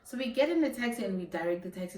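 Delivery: ordinary delivery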